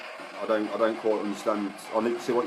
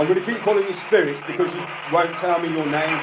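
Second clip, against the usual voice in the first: forceful command; 7 semitones higher, 3 dB louder than usual. Real, not acted. Ghost hunter demanding a hiding spirit's name, commanding. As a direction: order it out with full authority.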